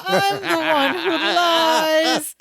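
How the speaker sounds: high, very slow drawn-out wail; mock bawling. Imitating Larry confessing he lies, mock crying. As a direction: bawl it out, hammed up. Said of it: "And then Larry cries again".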